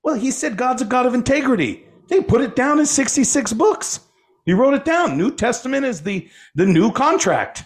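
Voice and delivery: high-pitched imitation of indignant insistence; chip on the shoulder. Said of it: "they kinda have this little chip".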